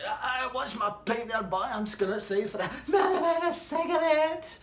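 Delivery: Big Sargy telling the pub he's off for a cigarette, high odd voice; high put-on Big Sargy voice. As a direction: squeak it out like the big man heading for a smoke. Big Sargy's habitual line.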